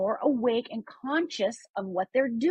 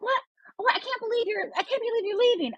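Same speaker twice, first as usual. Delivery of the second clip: much higher, exaggerated disbelief; mock dismayed friend; 12 semitones higher than usual. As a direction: ham up a friend shocked you're leaving.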